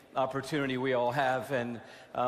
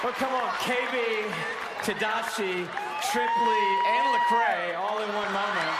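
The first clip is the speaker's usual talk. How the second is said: high, loud excited cheer; excited shout; 6 semitones higher, 4 dB louder than usual. Real, not acted. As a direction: hype it up after the show. Host excited after performance.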